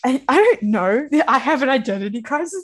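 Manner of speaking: laughing mock identity crisis; laughing through words. Real, not acted. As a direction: giggle it out, lost. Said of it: "I don't know who I am anymore".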